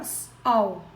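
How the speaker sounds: "sol" broken as s, pause, ol; wrongly split word. Shown as a demonstration. Teacher showing an error, "sol" wrongly split after s. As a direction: separate the s from the rest of "sol". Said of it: "E muito menos fazer isso aqui".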